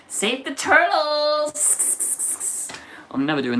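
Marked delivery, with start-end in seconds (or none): 0.1-1.6 put-on high, slow valley girl; VSCO girl voice. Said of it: "transformed into a Visco girl".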